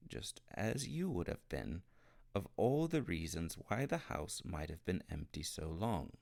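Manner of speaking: usual speaking voice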